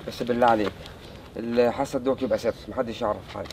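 hushed plea. Acted. Emad begs his friend in a hushed voice to keep it secret. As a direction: keep it just between us.

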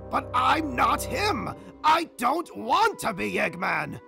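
loud, emphatic denial; desperate protest. Acted. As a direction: protest it at full voice. Protests being turned into Eggman.

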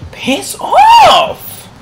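sudden high, loud shriek; screeching gamer rage; angry scream. Real, not acted. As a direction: explode at the opponent. Shouting at opponent.

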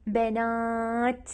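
بنات drawn out very slowly with a clear final ت; slow emphasized word. Shown as a demonstration. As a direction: stretch بنات and land the ت. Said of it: "لو بنات ت،".

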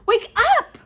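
high, loud rousing shout; loud wake-up call. A narrator's reading. Hermione bursting in on Harry and Ron, loud. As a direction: shout to rouse sleepers. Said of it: "she said loudly".

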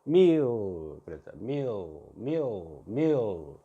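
"meal" as a gliding mi-o, L barely there; "meal" falling from a long ee into o; weak final L. Shown as a demonstration. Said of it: "이렇게 발음해야 돼".

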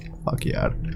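breathy hushed swear; scared whisper; whispered curse. Real, not acted. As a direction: swear under your breath. Muttered curse in fear.

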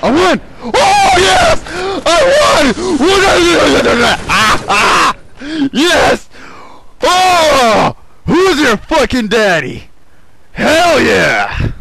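very high, loud triumphant shrieks; gloating winner yelling; victory screaming. Real, not acted. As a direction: scream the win with full joy. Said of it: "I started screaming a little bit much".

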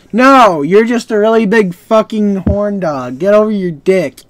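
loud teasing rebuke; mocking scold. Real, not acted. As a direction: tell him off, half joking.

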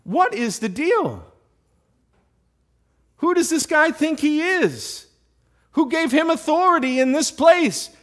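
high, loud, outraged challenge; fired-up disbelief, pitched high; indignant David. Acted. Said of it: "you know what David's saying?".

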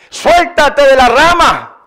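God's insistent command. Retold or imitated. God testing the climber's belief, insistent command. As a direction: press the order firmly, stretching it out. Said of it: "Si en verdad me crees".

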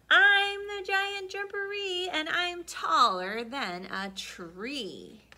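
booming, slow, menacing giant threat; loud monster voice. Acted. Hidden giant booming threats at the cat. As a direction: loom over the cat with each word. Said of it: "he heard a voice".

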